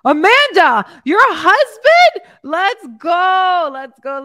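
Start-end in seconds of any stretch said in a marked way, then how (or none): 0.1-2.3 louder and higher, a delighted shout of surprise; thrilled exclamation. Real, not acted. Thrilled at husband joining. 2.4-3.7 loud, higher cheer; cheering shout. Real, not acted. Cheering Amanda's husband.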